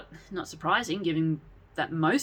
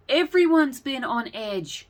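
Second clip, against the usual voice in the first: a higher, louder, slower retort; snapping back; 8 semitones higher, 5 dB louder, slower than usual. A narrator's reading. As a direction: bite back at them, fed up. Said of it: "Daniel snapped back".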